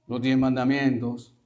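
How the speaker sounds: normal talking voice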